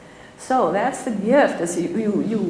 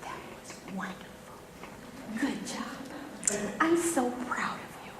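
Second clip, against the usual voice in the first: soft voiceless praise, very quiet; tender whisper; 2 semitones higher, 11 dB quieter than usual. Retold or imitated. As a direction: whisper praise like a proud grandmother. Kind lady praising after talk.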